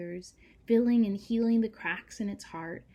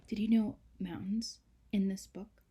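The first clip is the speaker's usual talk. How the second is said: ordinary delivery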